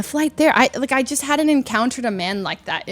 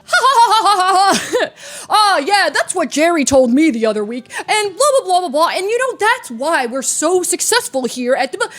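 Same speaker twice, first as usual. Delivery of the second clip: loud, high booming laugh into loud chatter; really loud man; 5 semitones higher, 4 dB louder than usual. Retold or imitated. Businessman holding forth in the lobby, really loud. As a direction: mimic an oblivious loudmouth yapping. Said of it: "just really loud".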